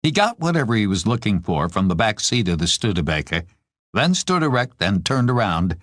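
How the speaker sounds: usual speaking voice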